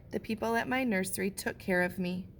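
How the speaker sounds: ordinary delivery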